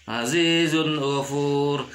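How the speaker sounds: slow "Azizun ghafur" with a clean n; "Azizun ghafur", long vowels and unhummed n; clear idhar reading. Shown as a demonstration. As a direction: cut the n of "Azizun" before "ghafur". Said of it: "tidak boleh dibaca".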